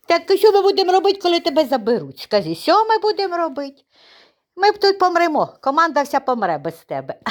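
high, sing-song pet talk. Real, not acted. Owner pleading with the dog in high baby talk. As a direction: coo at the puppy with a lifted voice.

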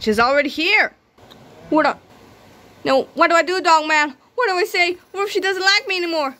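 high, rushed anxious voice; nervous panic. Acted. Chief panicking to Dog Man about his date.

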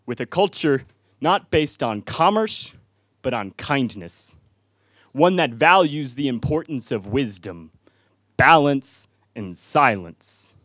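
measured recital with weighty pauses; spoken-word poem. Real, not acted. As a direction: set each virtue apart for the audience. Recites his poem to audience.

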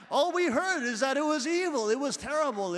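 loud, high protest; exasperated outburst. Real, not acted. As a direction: throw it out in frustration. Exasperated complaint about teaching.

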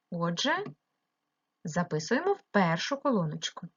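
normal talking voice

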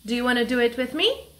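ordinary talk

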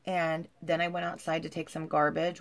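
ordinary delivery

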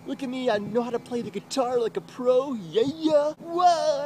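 high, gushing mock bragging; mocking show-off. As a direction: parody someone flaunting a perfect happy life.

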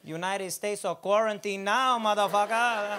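slow, loud mock Mexican accent, laughing through it; a lightly put-on Mexican accent, taunting and slow; Mexican accent virus. Comic voicing the virus taunting America in a mock Mexican accent. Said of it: "this virus ain't got a Mexican accent".

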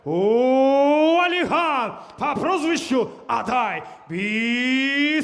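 boxing ring announcer. Acted. Ring announcer fighter intro.

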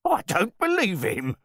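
loud, very high-pitched protest; blustering host losing control; outraged cry. A narrator's reading. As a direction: cry out to the guests in disbelief. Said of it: "cried out to his guests".

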